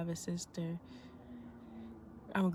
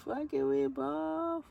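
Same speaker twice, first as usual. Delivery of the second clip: higher, slow and punchy; hyped mantra; 8 semitones higher, 3 dB louder, much slower than usual. Shown as a demonstration. She hypes herself up before her sister's birthday. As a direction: chant it to pump yourself up. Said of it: "repeats phrase variously".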